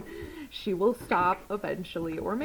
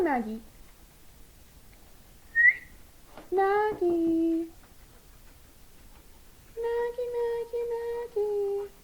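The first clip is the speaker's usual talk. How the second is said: coaxing the puppy; 12 semitones higher than usual. Real, not acted. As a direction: coax the scared puppy over sweetly.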